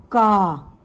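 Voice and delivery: का said slowly and clearly as a syllable; sounding out का. Shown as a demonstration. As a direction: model the syllable का for the children. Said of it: "तो का पूरा बन गया ये". (का को साफ़ बोलकर दिखाना)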